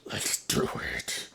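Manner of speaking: creepy villain whisper; evil voice. Acted. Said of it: "creepy sounding".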